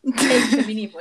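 host seizing the cue, loud and emphatic. Real, not acted. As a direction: land the phrase like a headline. Picks up topic emphatically.

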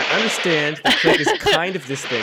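talking through a laugh. Real, not acted. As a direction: laugh while you say it. Laughing about Click comparison.

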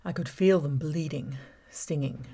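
plain reading voice

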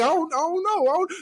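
mock small boy guessing letters; imitated little kid. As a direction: spell it out like a lost child. Kid replying.

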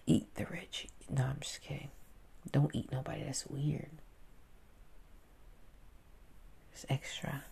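soft unvoiced whispered asides; whispered joke. Real, not acted. Vlogger joking about eating the rich, whispered. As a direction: drop to a whisper.

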